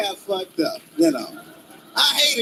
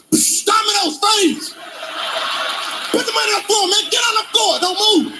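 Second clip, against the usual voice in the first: mock stickup shout; 8 semitones higher than usual. Armed pizza man robbing.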